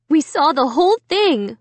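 high-pitched exclaiming mom; mother's exclamation. A narrator's reading. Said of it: "Mom exclaimed".

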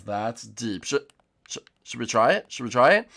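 ordinary talk

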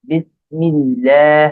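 বিসমিল্লাহ very slowly, syllable by syllable; pronunciation demo. Shown as a demonstration. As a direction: draw out the doubled l and long "laah" in বিসমিল্লাহ. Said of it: "বারবার practice করবেন".

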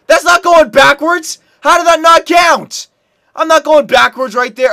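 high, loud incredulous protest; indignant protest. Real, not acted. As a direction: argue loudly against a bad call. Still disputes the disallowed goal.

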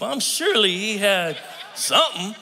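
half-shouted mock protest; joking protest to Jesus. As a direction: insist on it, playfully loud. Insisting to Jesus, shout heard.

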